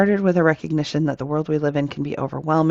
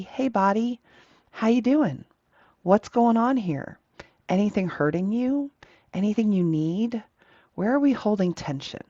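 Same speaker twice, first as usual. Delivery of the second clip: higher-pitched friendly questioning; lifted, caring questions with small pauses between; kindly, thoughtful check-in; 5 semitones higher than usual. Acted. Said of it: "you would do that kindly and thoughtfully".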